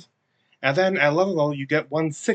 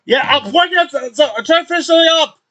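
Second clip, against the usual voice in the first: loud busy brush-off; 14 semitones higher, 11 dB louder, faster than usual. Acted. Busy NPC brushing them off.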